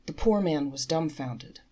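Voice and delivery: usual speaking voice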